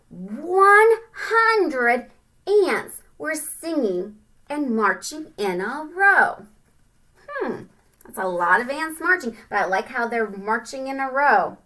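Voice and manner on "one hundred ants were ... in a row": very slow, louder and higher storybook read; slow sing-song read-aloud. Acted. Teacher reading the ants' march, slow and sing-song. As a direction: stretch it out for the kids.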